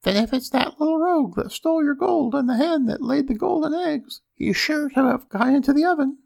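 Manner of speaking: very high-pitched wife character; ogre's wife. A narrator's reading. Ogre's wife pointing her husband to the oven, high voice. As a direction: keep it piped up high throughout. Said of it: "says the ogre's wife".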